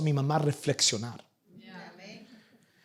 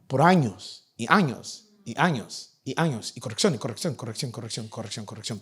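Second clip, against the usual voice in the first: fading repetition; 5 semitones lower, 5 dB quieter than usual. Acted. Endless correction fading away.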